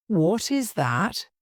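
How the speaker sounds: ordinary delivery, nothing marked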